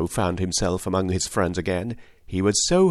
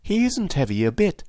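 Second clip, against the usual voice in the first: put-on quick, higher eager girl voice; eagerly; 9 semitones higher than usual. A narrator's reading. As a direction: rush to reassure the stork. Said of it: "said Dorothy eagerly".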